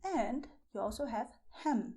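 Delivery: ordinary talk, nothing marked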